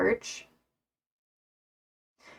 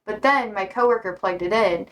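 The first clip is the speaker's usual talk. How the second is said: ordinary delivery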